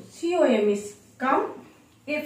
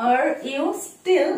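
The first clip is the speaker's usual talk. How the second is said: slow; 3 semitones higher, much slower than usual. Shown as a demonstration. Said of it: "এটার জন্য বলবো".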